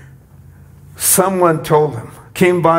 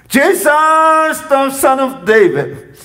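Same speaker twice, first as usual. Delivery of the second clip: shouted plea; 8 semitones higher than usual. Retold or imitated. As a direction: cry out to be heard over the crowd. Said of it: "To ask of God".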